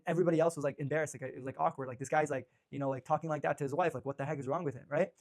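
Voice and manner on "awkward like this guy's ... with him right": normal talking voice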